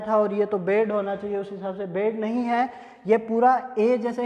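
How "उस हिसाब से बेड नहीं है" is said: normal talking voice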